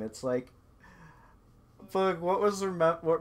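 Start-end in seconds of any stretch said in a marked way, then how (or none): none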